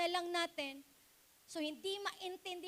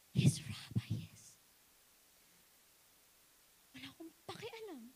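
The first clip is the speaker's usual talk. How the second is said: intense whisper. A narrator's reading. Climax of Mary's devotion.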